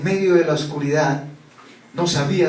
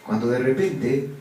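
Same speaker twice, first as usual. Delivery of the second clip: ordinary talk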